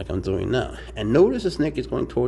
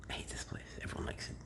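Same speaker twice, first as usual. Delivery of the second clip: hushed confiding whisper; breathy whispered aside; 17 dB quieter, much faster than usual. Real, not acted. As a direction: lean in and whisper it like a secret.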